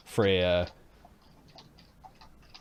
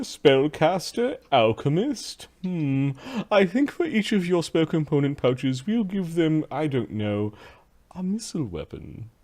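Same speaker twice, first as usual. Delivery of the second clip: haughty slave master; 6 semitones higher, slower than usual. Acted.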